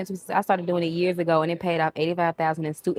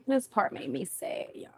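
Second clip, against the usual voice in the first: soft whispered aside; hushed sad whisper to viewers; 4 dB quieter than usual. Real, not acted. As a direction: whisper it so the movie isn't disturbed. Sad movie scene playing.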